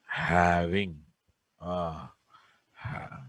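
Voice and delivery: slow, soft dictation for note-taking. Acted. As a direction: read it out word by word, slowly. Dictating notes slowly.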